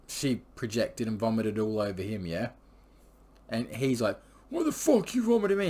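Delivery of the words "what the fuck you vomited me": high-pitched, loud retold outburst; angry yell. Boyfriend yelling at the girl after she vomits on him, high angry shout. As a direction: shriek it like an outraged thug.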